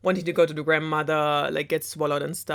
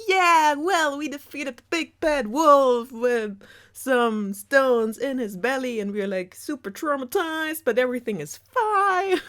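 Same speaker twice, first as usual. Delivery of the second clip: high, loud, breathless mock relief; mock rescuers; 8 semitones higher, 4 dB louder than usual. Rescuers boasting to the story's listeners in mock relief. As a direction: gush it like shaken survivors pretending all is fine. Rescuers voiced after killing wolf.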